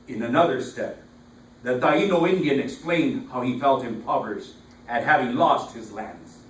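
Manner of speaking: declaimed verse, each phrase launched loud and falling away; poetry recital. Acted. Poet reciting to an audience about Puerto Rico's roads, declaimed. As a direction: recite it with force, pausing between phrases.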